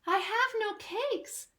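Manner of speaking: high, slow timid rabbit; rabbit reply. Acted. Storyteller voicing the rabbit answering the rat, high and timid. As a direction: squeak it like a small rabbit. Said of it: "the rabbit replied".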